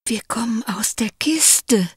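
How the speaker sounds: hohes, tonloses Flüstern; verschwörerisch hauchen; flüsternd. A narrator's reading. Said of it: "flüsterte der Kurze". (tonlos geflüstert)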